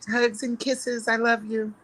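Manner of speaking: high, sweet sign-off; drawn-out affectionate goodbye; gushing affection. Retold or imitated. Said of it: "I'm just very emotional".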